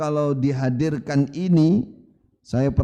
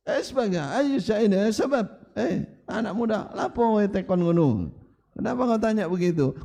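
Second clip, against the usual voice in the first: imitated telling-off, voice pitched up; old man's scolding; 6 semitones higher than usual. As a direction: play an indignant elder challenging a young questioner. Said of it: "pernah dibentak orang sudah sepuh".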